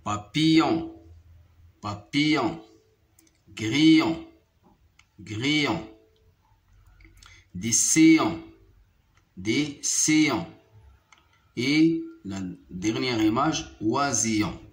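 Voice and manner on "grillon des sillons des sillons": ordinary delivery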